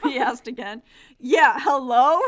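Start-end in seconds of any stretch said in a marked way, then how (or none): 1.2-2.2 loud phone call. Acted. Bobby calling down the phone.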